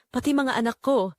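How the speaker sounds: ordinary delivery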